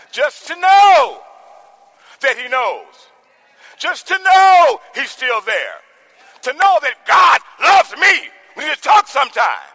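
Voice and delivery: high, loud, stretched preaching; preaching at full force. Acted. Preacher driving home the tug, loud and high. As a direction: lift the church with each line.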